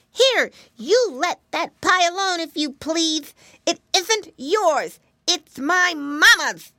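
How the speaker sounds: loud, high, slow piglet scolding; indignant shout. A narrator's reading. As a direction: shout it like a brave little pig. Flop stops the dog.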